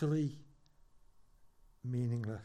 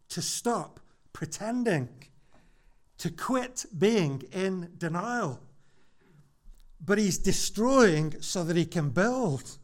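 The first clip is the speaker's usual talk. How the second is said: slow, higher and louder, building conviction; rising preaching climax; 6 semitones higher, 6 dB louder than usual. Acted. Pastor urging congregation toward hope, rising preaching. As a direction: drive it home from the pulpit.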